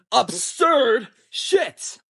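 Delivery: "absurd" stretched very long and high; emphatic outrage. Real, not acted. Storyteller builds up her shocking answer, exaggerated emphasis on "absurd".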